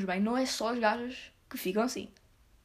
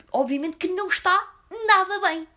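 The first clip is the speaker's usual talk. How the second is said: exasperated; 6 semitones higher, 4 dB louder than usual. Real, not acted. Exasperated at obvious question.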